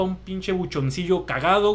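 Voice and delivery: ordinary delivery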